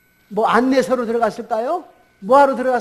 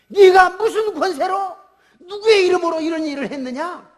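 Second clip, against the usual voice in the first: loud, high, accusing challenge; acted-out official's indignant demand; stern interrogation; 8 semitones higher, 5 dB louder than usual. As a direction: challenge them like an outraged authority. Religious leaders interrogate the apostles.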